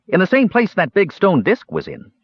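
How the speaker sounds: high, eager kender helpfulness; helpful kender. A narrator's reading. Kender Earwig helping the Cat Lord explain, high and eager. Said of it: "Earwig stated helpfully".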